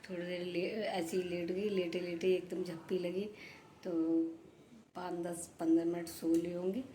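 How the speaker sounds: softly telling viewers about her short nap; hushed voice. Real, not acted. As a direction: keep it down while someone sleeps. बात करूँगी तो जग जाएंगी.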